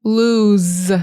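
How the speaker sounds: Lose. with a Z, not an S; Z sound. Shown as a demonstration. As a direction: say Lose. with a strong final Z. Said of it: "it has that hard S, that Z".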